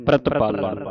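ਪ੍ਰਤਪਾਲਨ joined up, pitched low; read as one word. Shown as a demonstration. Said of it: "ਇਕੱਠਾ ਪੜ੍ਹਨਾ".

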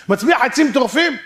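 high, loud query rising on "טורפים"; climactic question. Acted. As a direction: push "טורפים" up in disbelief.

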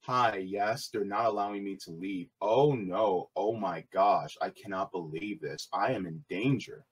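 mock a fake damsel, quiet and limp; mock helpless voice. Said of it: "She's just like".

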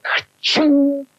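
ka-ching sound effect. Acted. Lever machine noise imitated.

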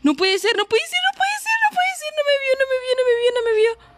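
very high panicked squeal. Real, not acted. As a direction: squeal in disbelief while hiding. Hiding from the spider, panicking. (chillido de pánico)

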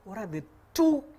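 ordinary talk

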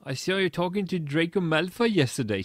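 high, excited gushing. A narrator's reading. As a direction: gossip excitedly to a friend. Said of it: "she said excitedly".

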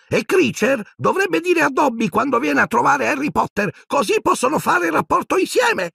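very high, squeaky house-elf voice, rising to a shout; indignant little elf telling off a rival. A narrator's reading. Dobby scolding Creature, very high squeaky shout.

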